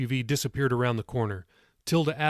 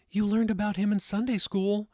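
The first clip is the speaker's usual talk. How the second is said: higher-pitched, lightly voiced mom; reminding mother; 9 semitones higher than usual. A narrator's reading. Molly reminds her son of Sunday school, gentle reminder in a higher voice. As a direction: prompt him in a higher mom voice. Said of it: "Molly reminded him".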